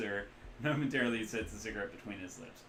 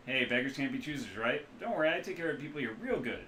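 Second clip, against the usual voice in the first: loud, loose Midwestern rigger; Midwestern accent; 5 semitones higher, 5 dB louder than usual. Acted. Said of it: "loose Midwestern accent".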